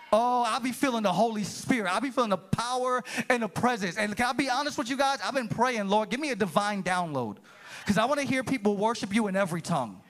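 high, impassioned preacher voice; fervent preaching. Real, not acted. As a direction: build to a high, rousing sermon climax. Preaching peaks on every nation.

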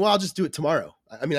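ordinary talk

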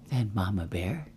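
plain reading voice

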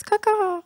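bird caw. Pretend. Mimicking a bird as a joke.

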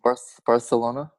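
Barcelona with a th replacing the c. Shown as a demonstration. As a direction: break after "Bar-", then lisp the c in Barcelona. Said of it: "Bar-, like T H?".

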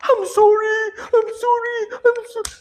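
very high, squeaky mock wail; imitated crying apology. Woman who insulted the preacher, now crying in remorse, imitated. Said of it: "she started crying".

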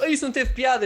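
high, loud mocking whine; whiny critic. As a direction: mimic a snide Twitter critic. Twitter critic voiced.

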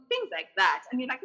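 ordinary talk, nothing marked

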